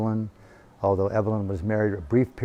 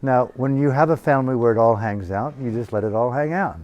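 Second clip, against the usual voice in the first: normal talking voice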